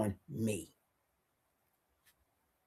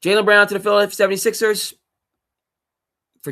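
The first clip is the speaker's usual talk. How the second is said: high, fast, loud headline call; hype-man announcer voice; mock announcer; 9 semitones higher, 11 dB louder, faster than usual. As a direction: blast it like a breaking news promo.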